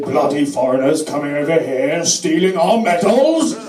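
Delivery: mock xenophobic rant, drawn out at the end; sarcastic impression of an indignant politician; Nigel Farage. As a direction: put on a blustering complainer, stretching the last words. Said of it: "Nigel Farage, I think, so eloquently put it".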